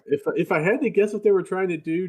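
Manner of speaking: ordinary talk, nothing marked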